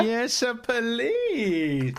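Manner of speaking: high, stretched-out mock delight; hammed-up, sing-out enthusiasm; gleeful relish. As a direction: beg for it with comic eagerness. Playful eager approval of the pairing.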